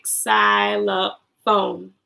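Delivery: "Xylophone" slowly, beat by beat; drawn-out syllable demo of "Xylophone"; broken into syllables. Shown as a demonstration. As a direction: count out each syllable of "Xylophone". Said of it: "How many syllables".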